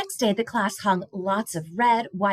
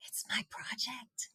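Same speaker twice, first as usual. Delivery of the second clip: soft, slow whisper; boy whispering to his mom; 4 semitones higher, 14 dB quieter, slower than usual. A narrator's reading. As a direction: whisper it through the crowd. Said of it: "whispered Caleb".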